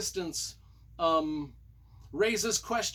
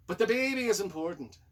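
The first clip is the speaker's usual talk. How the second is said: higher-pitched put-on Scottish accent; mock Scottish; 4 semitones higher, 4 dB louder than usual. Reviewer stressing the baby's importance, in mock Scottish. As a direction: play at being Scottish, hammed up. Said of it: "saying that in Scottish".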